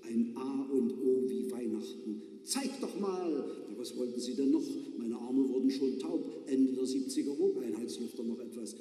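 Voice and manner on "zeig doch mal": calling from the back. Acted. Passenger calls from behind.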